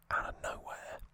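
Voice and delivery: ordinary delivery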